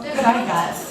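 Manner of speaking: usual speaking voice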